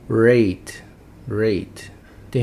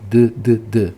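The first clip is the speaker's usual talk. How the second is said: three louder "duh" bursts; voiced D sound; 6 dB louder, slower than usual. Shown as a demonstration. Teacher recapping voiced endings, punched duh sounds. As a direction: voice each bare D separately. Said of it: "D é vozeado".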